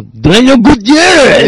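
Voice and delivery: high, loud drawn-out name; mock-angry hammed-up holler; mad guy yell. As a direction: bellow the name like a crazed character. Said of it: "Why do I sound like a ... mad Chinese guy".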